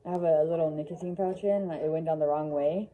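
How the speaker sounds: muffled masked speech; talking behind surgical mask; through a face mask. Real, not acted. Seen 0: Blue mask worn throughout; the nicotine pouch cannot be seen under it.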